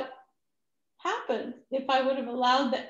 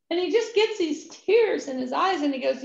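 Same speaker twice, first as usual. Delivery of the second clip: normal talking voice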